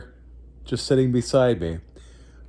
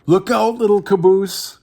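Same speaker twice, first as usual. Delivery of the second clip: higher, louder urgent call; 8 semitones higher, 5 dB louder than usual. Acted. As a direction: shout a warning down the train. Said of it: "called the flat car".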